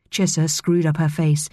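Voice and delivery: ordinary delivery, nothing marked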